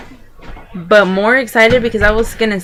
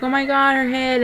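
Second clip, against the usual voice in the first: higher-pitched retold gasp of wonder; imitated amazed mom; 6 semitones higher, 3 dB quieter than usual. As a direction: lightly act out mom's astonishment. Mom's reaction at the birth retold.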